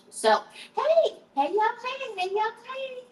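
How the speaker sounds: high, sing-song call to viewers; high playful greeting call; sing-song hey. Real, not acted.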